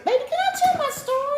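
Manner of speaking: high, loud exasperated protest; exasperated plea. Real, not acted. Storyteller to interrupting partner, real exasperated protest. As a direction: cut in on the heckler, voice shooting up.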